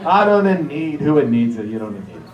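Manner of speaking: mock Italian-accented shrug, fading away; heavy Italian accent. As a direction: dismiss it in Grandma's Italian accent. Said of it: "a very heavy Italian accent".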